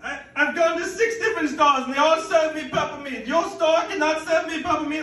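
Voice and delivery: high, loud and fast outraged shrieking; lady customer raging at the meat clerk; indignant lady customer. Retold or imitated. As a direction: squawk at the clerk like an offended grandmother. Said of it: "And she's like".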